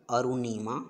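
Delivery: ordinary delivery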